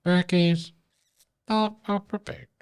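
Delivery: words pushed out on a burp. Acted. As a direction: burp through the segment title. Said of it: "Burp from Berkey".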